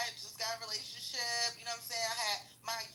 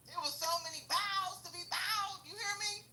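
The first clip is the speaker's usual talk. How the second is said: hyped up; 10 semitones higher, 4 dB louder than usual. Real, not acted. Hyped retelling of the fight.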